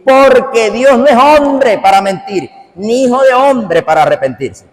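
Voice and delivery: high, slow scripture proclamation; versículo declamado. Real, not acted. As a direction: proclaim the verse forcefully, drawing it out. Declaims a Bible verse. (declamación bíblica enérgica, aguda y pausada)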